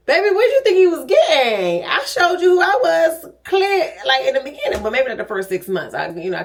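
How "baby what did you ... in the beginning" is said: higher, louder playful retort; playful protest. Real, not acted. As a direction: call it out, mock offended.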